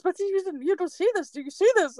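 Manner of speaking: high, alarmed, insistent questions; spooked helper pressing Marie to see the demon, high and alarmed; frightened disbelief. Retold or imitated. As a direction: voice her as scared and insisting she look.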